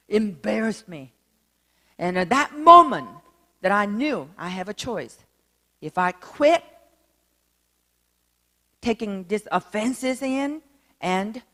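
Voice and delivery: ordinary delivery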